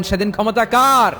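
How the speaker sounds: high, loud, falling shout; thundering question to the congregation; loud demand. Acted. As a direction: call it out so all can hear. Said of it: "আওয়াজ করে বলেন".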